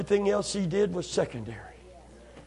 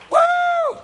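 normal talking voice

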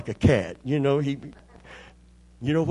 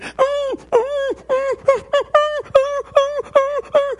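very high whimpering dog cries; dog whining; 4 dB louder, much slower than usual. Retold or imitated. Preacher imitating his dog whining during the hug, very high. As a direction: whine like an excited little dog. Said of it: "he started saying".

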